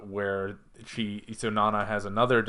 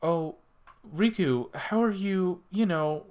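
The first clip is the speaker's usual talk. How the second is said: higher-pitched, put-on voice of a questioner; Nana asking; 8 semitones higher than usual. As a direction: imitate a pointedly delicate question. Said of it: "Nana will ask".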